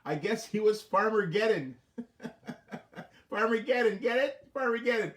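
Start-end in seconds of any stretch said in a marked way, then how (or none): 2.0-3.0 quick unvoiced chuckle at his own joke; airy self-amused laugh; breathy laugh. Real, not acted.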